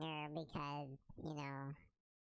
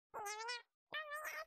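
very high, slow processed voice; squeaky pitch-shifted singer; chipmunk voice; much slower than usual. Acted. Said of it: "I sing in front of you".

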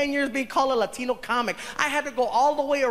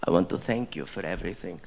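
gracious prince; 10 semitones lower than usual. Retold or imitated. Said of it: "he says".

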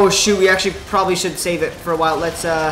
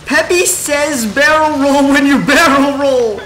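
thrilled amazement; 7 semitones higher, slower than usual. Real, not acted. Gamer discovering that Peppy says barrel roll, thrilled. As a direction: shout it out in delighted disbelief. Delighted discovery of a game joke.

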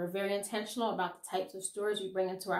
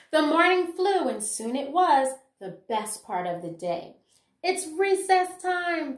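lively, sing-song picture-book reading; reading to children; 4 semitones higher, 5 dB louder, slower than usual. Acted. Teacher reading a rhyming picture book to her class, lively storytelling. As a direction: bring the rhymes alive for little listeners.

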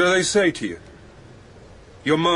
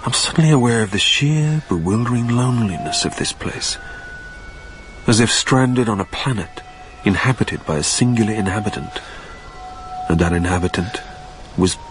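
story narration; 8 semitones lower than usual. A narrator's reading. Narrator alone among the hangars in a low, lonely tone. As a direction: let the loneliness sink in, low.

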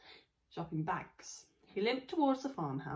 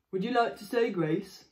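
father voice; 3 semitones higher, 7 dB louder than usual. Acted. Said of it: "father asks daughter".

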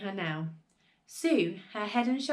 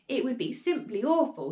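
usual speaking voice

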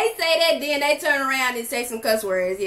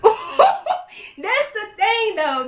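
laughing; 5 semitones higher, much slower than usual. Real, not acted. Laughing while reacting to clip.

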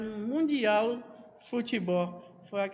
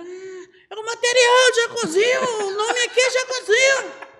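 imitated insistent shout; 13 semitones higher, 11 dB louder than usual. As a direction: bellow it high, stretching the words. Imitates reporter insisting at full volume. (berro imitado, agudo e insistente)

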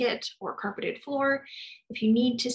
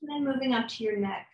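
calm, soft guided meditation voice, unhurried with long pauses; guided body scan; 5 dB quieter than usual. Acted. Therapist guiding a listener through a body scan, calm and soft. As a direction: lead them gently through the body.

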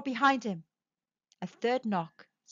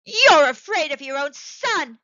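loud, higher, outraged accusation; accusing cry; 5 semitones higher, 11 dB louder than usual. A narrator's reading. Wife accusing husband who holds her back, loud cry. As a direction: hurl it out while struggling. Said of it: "she cried, struggling".

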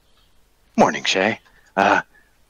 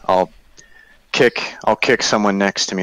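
normal talking voice

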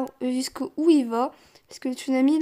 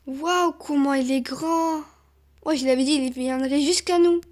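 usual speaking voice

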